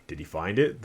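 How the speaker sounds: ordinary talk, nothing marked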